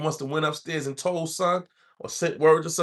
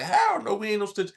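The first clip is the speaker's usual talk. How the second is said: high-pitched retold protest; indignant denial; 8 semitones higher than usual. Two new inmates denying they are snitches, indignant. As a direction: deny it as if insulted, pitch jumping up.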